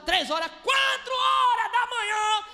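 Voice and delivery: slow, loud shout as the hours drag on; drawn-out shout. Real, not acted. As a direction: stretch each hour out loud.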